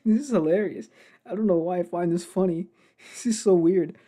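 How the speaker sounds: real helpless amusement in the voice; laughing. Real, not acted. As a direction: talk through a fit of laughter. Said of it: "me laughing at this".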